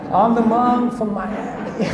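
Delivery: put-on lightly laughing American accent; half-laughing Miami monk line. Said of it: "speaking as an American accent".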